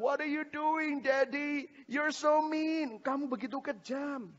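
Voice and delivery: put-on high-pitched wailing toddler; imitated little-kid complaint, drawn out at the end; hurt child. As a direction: accuse daddy in a tearful small-child voice. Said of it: "Dia bilang".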